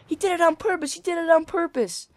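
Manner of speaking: squeaky retold accusation; high-pitched kid voice. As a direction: imitate a child accusing in a high whine. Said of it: "in my little high-pitched voice".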